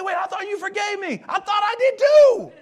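loud, high mock blow-up; shouting. Spouse blows up over the held grudge, loud shout. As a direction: yell it like the fight finally boils over. Couple blowing up.

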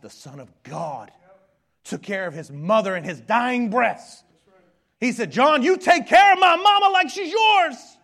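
impassioned preaching climax; building preach. Acted. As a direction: swell to a full pulpit shout. Preaching crescendo.

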